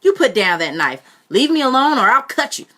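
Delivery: put-on cornered boy screaming at his aunt; threatening scream.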